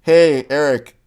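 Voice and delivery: loud mock coaching call from the sideline; imitated yelling out. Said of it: "you really shouldn't be yelling out".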